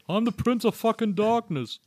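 high slow [name] voice; drawn-out rock star catchphrase; Ozzy impression.

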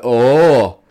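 loud, hammed-up South African accent; mock South African accent. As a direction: bellow it like a South African mate. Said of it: "that accent was meant to be South African".

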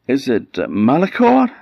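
very high, rising question; hesitate, then try a name hopefully; tentative guess. A narrator's reading. Queen tries another name on the little man, tentative.

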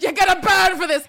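high, loud hammed-up threat; mock outraged threat. Paul threatens Carolyn over his shot statue, hammed-up. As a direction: wail it like a wounded diva. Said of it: "And he was like".

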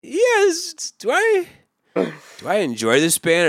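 squeaky high doubtful voice; high-pitched. Retold or imitated. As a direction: rise way up, unsure. Retells own doubtful reaction.

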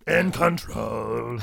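slow, growled muppet voice; Animal voice. Acted. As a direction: drag it out like Animal. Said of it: "How does he sound, Animal?".

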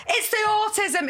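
high, loud, slow declaration; dramatic mum. Retold or imitated. Mum repeating.

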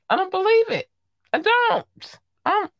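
very high squeak of disbelief; high-pitched disbelief. Real, not acted. Doubting R. Kelly's new baby, high-pitched disbelief. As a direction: squeak out your doubt in short bursts.

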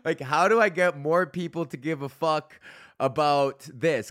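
words squeezed out through a laugh. Real, not acted. Host laughing while asking how to get people to care. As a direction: still giggling as you ask.

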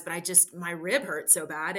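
usual speaking voice

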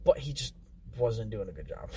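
ordinary delivery

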